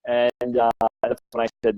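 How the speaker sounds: normal talking voice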